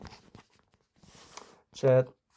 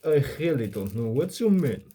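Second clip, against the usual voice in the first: higher-pitched, exaggerated Russian accent; 4 semitones higher, 3 dB louder than usual. Shown as a demonstration. Showing chat how English sounds in a Russian accent, first botched Russian accent. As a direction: lay on a thick Russian accent, swooping in pitch. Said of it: "speak English in a Russian accent".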